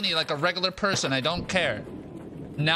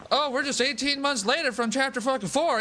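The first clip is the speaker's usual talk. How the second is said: high, sarcastic parody of the show; mock the line way up high; scoffing high-pitched parody; mocking quote; 10 semitones higher than usual. Mocks the show's time skip.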